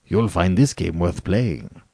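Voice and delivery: plain reading voice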